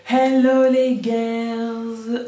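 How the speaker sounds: loud, slow drawn-out hello; bright greeting. Real, not acted. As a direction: sing out the greeting.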